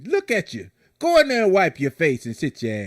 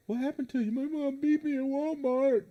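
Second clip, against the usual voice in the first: put-on kid voice; 10 semitones higher, 4 dB quieter than usual.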